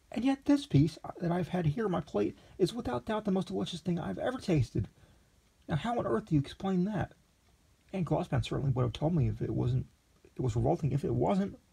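put-on high, breathless young gourmet in raptures; a naive young man overwhelmed by a dish; eager young Lexington. A narrator's reading. Lexington marvelling at the meat, then calling the waiter, high.

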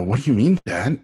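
taken aback, pushing back; shocked question. Retold or imitated. Shocked son reacts.

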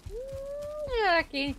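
high, drawn-out mmm of delight; savoring mmm. Acted. Gamer drooling over jerky in a cooking game. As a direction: relish the word like a treat.

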